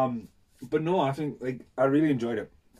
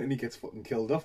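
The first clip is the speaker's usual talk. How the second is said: ordinary talk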